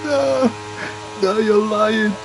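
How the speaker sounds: high, stunned no, no; stunned disbelief. Real, not acted. Streamer protesting a replay in stunned disbelief.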